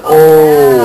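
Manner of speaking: a loud, dismayed "oh" dropped low; shocked outcry. Retold or imitated. Finding spit on her windshield, a loud shocked cry. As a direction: blurt it out in disgusted shock.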